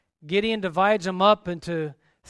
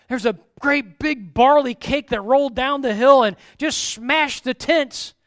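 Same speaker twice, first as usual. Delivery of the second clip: excited dream telling; 5 semitones higher, 7 dB louder than usual. Acted. Soldier tells his dream.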